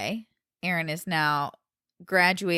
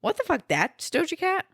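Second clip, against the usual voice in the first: mock shocked disbelief; 10 semitones higher than usual. Imagined listener shrieking at [name]. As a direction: act stunned at the song. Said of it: "and you'll be like".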